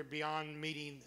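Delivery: ordinary delivery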